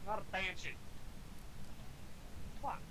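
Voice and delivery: usual speaking voice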